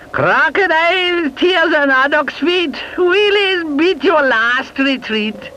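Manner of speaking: high slow chanted spell; witch incantation. A narrator's reading.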